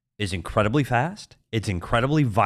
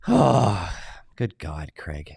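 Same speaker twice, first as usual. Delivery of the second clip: exasperated sigh; 8 semitones lower, much slower than usual. Real, not acted. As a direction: sigh it out, fed up with him.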